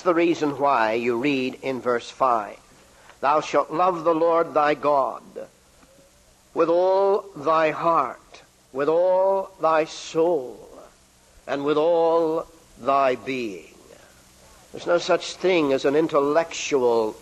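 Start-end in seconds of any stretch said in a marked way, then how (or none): 3.2-13.7 scripture reading. Acted. Preacher quoting scripture commandment.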